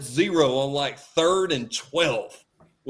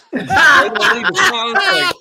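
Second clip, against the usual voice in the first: laughing loud; 12 semitones higher, 9 dB louder than usual. Real, not acted. Laughing punchline of story.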